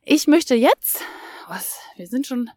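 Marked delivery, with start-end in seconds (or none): 1.5-2.5 whispering. Real, not acted. Whispered aside mid-sentence.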